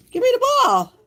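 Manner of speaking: high, loud dog talk; playful dog commands. Real, not acted. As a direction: call for the ball in a squeaky-high voice. Playing fetch with dog.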